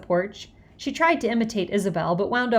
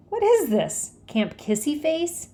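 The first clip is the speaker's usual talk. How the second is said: 1 mocking question; 7 semitones higher, slower than usual. A narrator's reading. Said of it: "Jimmy asked".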